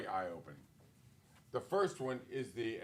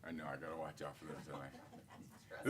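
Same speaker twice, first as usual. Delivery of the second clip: hushed aside; 10 semitones lower, 11 dB quieter than usual. Real, not acted. Aside about watching himself.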